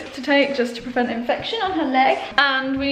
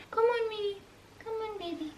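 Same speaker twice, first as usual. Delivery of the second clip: coaxing pet talk; 9 semitones higher, 14 dB quieter than usual. Real, not acted.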